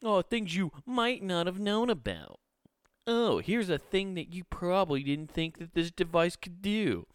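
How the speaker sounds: sneering imitation of a know-it-all influencer; passive-aggressive mock. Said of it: "They're kind of passive-aggressive".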